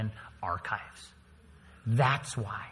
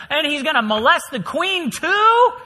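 higher, much louder outraged yell; king bellowing in outrage at the scene; furious shout; 13 semitones higher, 11 dB louder than usual. Acted. King catches Haman on queen.

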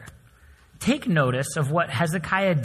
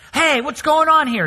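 high, loud angry yell; 11 semitones higher, 7 dB louder than usual. Retold or imitated. Angry customer yelling at the server, high and loud. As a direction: shout it at the top of your lungs. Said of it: "yells at him at the top of his lungs".

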